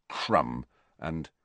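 low muttering under breath; muttered. A narrator's reading. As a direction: mutter it barely audible. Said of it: "tailed away in mutters".

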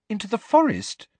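high, puzzled question; perplexed. A narrator's reading. Hermione questions where Hagrid is leading them, perplexed. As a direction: query it in high-pitched bewilderment.

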